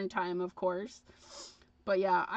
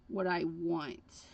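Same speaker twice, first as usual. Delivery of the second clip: ordinary talk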